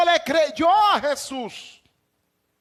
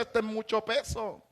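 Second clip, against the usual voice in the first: much softer, lower tempter's murmur; Satan's hushed taunt; 6 semitones lower, 11 dB quieter than usual. Acted. Satan tempting praying Jesus in the film, hushed and low. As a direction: slip the words in low like a tempter. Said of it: "le dice".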